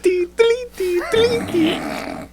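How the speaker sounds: high sing-song "deleety" effect; silly high-pitched warbling noise; imitated transition jingle. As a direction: trill it high like a cartoon flashback cue. Imitated flashback sound effect.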